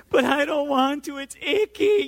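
put-on high, loud toddler whine; whining child. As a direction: imitate a little girl balking in disgust. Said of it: "she just begins to escalate".

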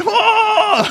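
long, very high comic "oh"; hammed-up wail breaking into laughter; mock panicked wail. Pastor mocking his own panic for the congregation, hammed-up wail. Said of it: "I'm, like, freaking out".

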